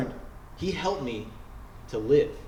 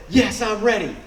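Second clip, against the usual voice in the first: eager; 7 semitones higher, 6 dB louder than usual. Retold or imitated. Retold eager reply, called out.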